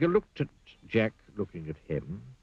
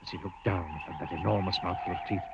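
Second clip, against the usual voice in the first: ordinary delivery, nothing marked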